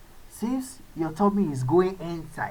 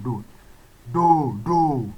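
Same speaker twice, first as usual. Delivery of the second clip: usual speaking voice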